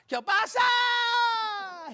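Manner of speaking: very high, long-held shriek; mock-horrified, drawn-out wail; hammed-up scream. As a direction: scream it as if falling off a building. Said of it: "The Polish governor's on the lunchbox goes".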